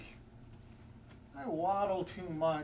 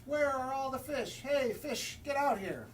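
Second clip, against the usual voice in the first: calling fish; 8 semitones higher than usual. Acted. Hungry penguin calling out for fish. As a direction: call out for fish, fed up.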